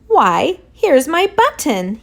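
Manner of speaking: light delight at finding the button; a touch of joyful exclaiming; happy cry. Acted. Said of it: "he cried".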